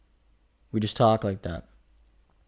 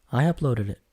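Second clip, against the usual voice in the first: ordinary talk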